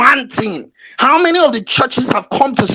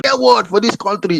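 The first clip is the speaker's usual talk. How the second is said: normal talking voice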